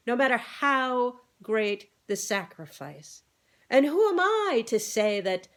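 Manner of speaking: grand oratorical speech with swelling pitch; self-important rooster oration; pompous orator. A narrator's reading. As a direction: declaim like a puffed-up politician.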